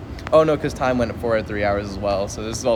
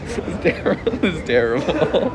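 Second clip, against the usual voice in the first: giggling through the words, embarrassed; breathless embarrassed giggle; laughing embarrassed; 6 semitones higher than usual. Real, not acted. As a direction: laugh it out, caught in public. Laughing as people look at him.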